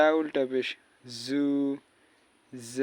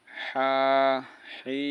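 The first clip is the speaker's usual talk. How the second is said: ordinary talk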